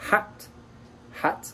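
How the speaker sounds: hat with a very short A; don't linger on the vowel in hat. Shown as a demonstration. Teacher demonstrating short vowels, hat with very short A. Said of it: "est donc très court".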